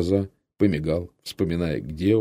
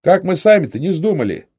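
high, loud holler; loud, high-pitched yell; yelling to comrades; 8 semitones higher, 7 dB louder than usual. A narrator's reading. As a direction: shout it out to all the others. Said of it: "заорал остальным".

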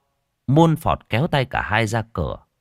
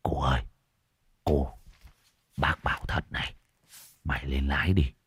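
hushed, low whisper in the ear; 6 semitones lower, 7 dB quieter than usual. A narrator's reading. Man warning the driver secretly, low whisper. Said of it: "thều thào ghé tai".

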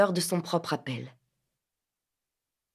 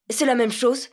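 louder, higher insistence; vehement protest; 5 semitones higher, 4 dB louder than usual. A narrator's reading. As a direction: protest hotly. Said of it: "protesta-t-il véhément".

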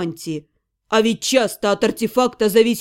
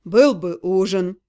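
lower, slow rueful sigh; 7 semitones lower, slower than usual. A narrator's reading. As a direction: sigh over the lost supper. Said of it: "вздохнул некромант".

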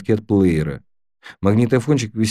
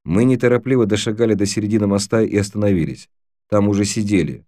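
plain reading voice

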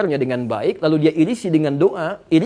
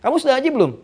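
lightly acted commander, pitched up; a hint of a commander's phone call; 9 semitones higher, faster than usual. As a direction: put a higher-pitched question as a retold superior. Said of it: "ditelepon sama komandannya".

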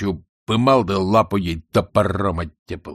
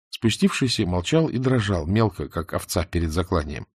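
plain reading voice

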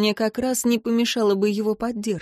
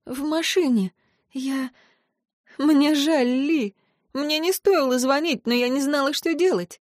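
tearful apology; 6 semitones higher than usual. A narrator's reading. Tearful heroine.

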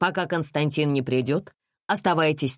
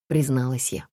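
plain reading voice

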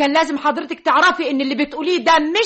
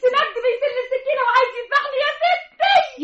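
panicked; 9 semitones higher than usual. Acted. Maid panicking to mistress.

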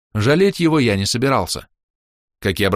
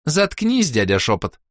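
put-on bossy girl; 7 semitones higher, slower than usual. A narrator's reading. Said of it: "повторила Роска".

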